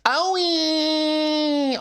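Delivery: long, high, held-out wail; mock pained wail. Streamer showing friend how they'd cry over an injury, mock wail. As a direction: whine it out like a hurt kid. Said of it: "I would say it like that".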